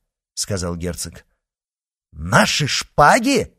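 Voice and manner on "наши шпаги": slow, louder incredulous question; louder, higher disbelief; indignant exclamation. Acted. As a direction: repeat it in outrage. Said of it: "воскликнул Д'Артаньян".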